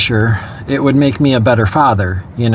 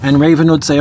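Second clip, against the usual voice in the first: usual speaking voice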